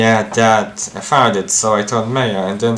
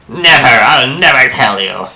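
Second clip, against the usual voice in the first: defiant shout; 9 dB louder than usual. Acted. Defiant refusal to confess.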